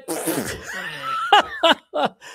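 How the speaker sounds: high, hearty acted belly laugh; a booming disbelieving laugh; big mock laugh. Abraham laughs at God's promise.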